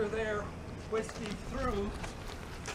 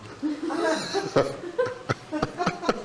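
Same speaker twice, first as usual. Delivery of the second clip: high laugh; 12 semitones higher than usual. Real, not acted. Guide surprised by fingers answer.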